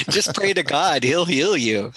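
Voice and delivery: high, laughing mock preacher. Mocking faith healing, laughing.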